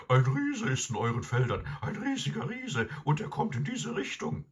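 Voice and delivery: very high, urgent alarm report; high-pitched, excited dog voice; talking dog. A narrator's reading. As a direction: raise the alarm as a frantic hound. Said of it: "sagte der Hund".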